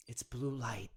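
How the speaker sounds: hushed conspiratorial whisper. Acted. Podcast host to listeners, a conspiratorial whisper. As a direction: lean in and breathe it like a secret. Conspiracy rant about blue light.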